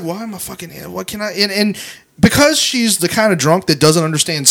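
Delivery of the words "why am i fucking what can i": much softer trapped mutter; muttered to himself. Retold or imitated. Trapped by the drunk woman, muttering very softly. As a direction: mutter it under your breath. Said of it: "I'm just like".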